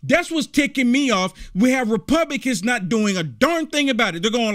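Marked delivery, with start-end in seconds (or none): none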